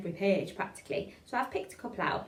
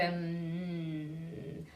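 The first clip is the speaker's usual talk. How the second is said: long low hum, lips together; closed-mouth hum; 4 semitones lower, 5 dB quieter than usual. Shown as a demonstration. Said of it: "like a hum with our mouths closed".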